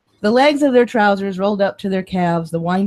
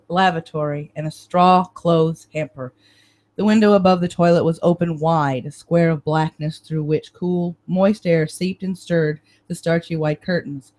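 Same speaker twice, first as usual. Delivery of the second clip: ordinary delivery, nothing marked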